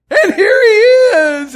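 high, drawn-out shout; big, stretched-out ringside-style holler; triumphant announcement. Acted. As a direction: announce a hero's entrance to the crowd. Acting out a crowd in heaven.

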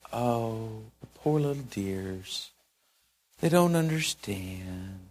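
soft, slow mock pity for the poor little dears; a gently mocking, patronising coo. Pretending to pity the billers, softly mocking. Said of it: "And you probably just said".

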